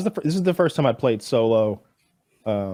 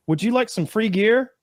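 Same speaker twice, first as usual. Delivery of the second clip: quoting cheater; 9 semitones higher than usual. Retold or imitated.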